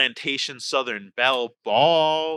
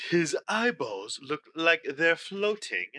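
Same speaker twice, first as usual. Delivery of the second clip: Southern accent; slower than usual. Shown as a demonstration. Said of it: "because I was doing Southern".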